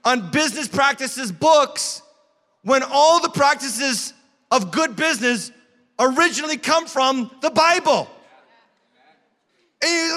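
high, loud, driving sermon voice; preaching at full force. Acted. Pastor rallies the congregation at full preaching force. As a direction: build to a preaching climax.

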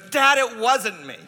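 put-on high, insistent child's protest; protesting son. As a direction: plead innocence to a parent. Son protesting to scolding father.

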